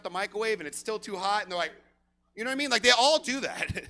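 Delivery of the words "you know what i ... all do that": ordinary talk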